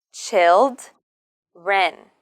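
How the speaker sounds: put-on "Children." split in two, child, ren; broken into syllables. Shown as a demonstration. Teacher breaks the word down for learners, syllable by syllable. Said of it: "we need to stress the first syllable".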